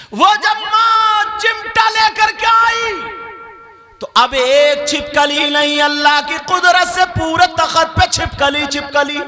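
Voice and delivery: high, loud chanting sermon delivery; high-pitched chanted exhortation; full-force preaching. Acted. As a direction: preach it loudly on a high sustained tone.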